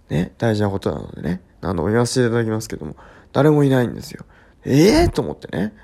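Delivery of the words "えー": loud, high, rising disbelief; retold astonishment. Resident finding nobody at the door, astonished cry. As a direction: exclaim in mock shock. Retold shock at empty doorstep.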